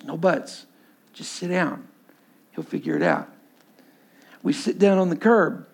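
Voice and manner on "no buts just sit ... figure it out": soft, hushed friend cutting off objections; calm insistence. Retold or imitated. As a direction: imitate a buddy gently but firmly settling someone. Friend quietly insists he sit down.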